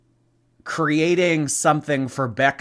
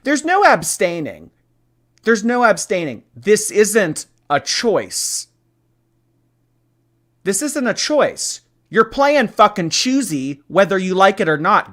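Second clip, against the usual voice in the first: mock bossy tyrant; 5 semitones higher, 4 dB louder than usual. Forcing the audience to play.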